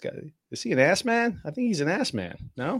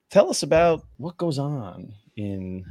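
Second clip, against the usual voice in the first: ordinary delivery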